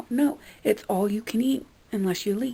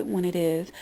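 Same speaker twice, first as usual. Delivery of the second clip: usual speaking voice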